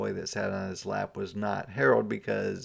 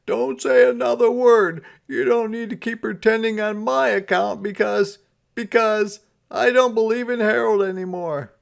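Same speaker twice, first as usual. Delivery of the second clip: high, loud put-on Santa voice; a sighing, higher Santa; 12 semitones higher, 8 dB louder than usual. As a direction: play him sulky and fed up.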